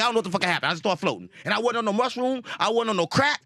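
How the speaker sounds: normal talking voice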